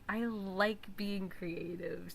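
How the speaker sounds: normal talking voice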